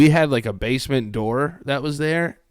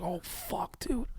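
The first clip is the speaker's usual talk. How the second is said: hushed, breathy whisper of shock; stunned whisper; 8 semitones higher, 12 dB quieter, slower than usual. Retold or imitated. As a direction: whisper it as if frozen in disbelief. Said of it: "I was like".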